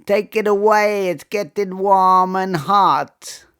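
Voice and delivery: put-on high-pitched voice, strong Caribbean accent; squeaky high Caribbean-accented voice. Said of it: "speak with a very high-pitched voice in a strong Caribbean accent".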